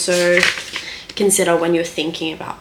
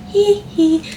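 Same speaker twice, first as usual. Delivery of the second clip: mock giggle; 13 semitones higher than usual. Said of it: "I'm like".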